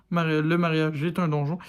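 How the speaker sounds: ordinary delivery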